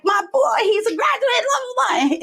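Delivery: high-pitched retold gushing; hammed-up excited squeal. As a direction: imitate yourself all excited and proud. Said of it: "I was, like, all excited".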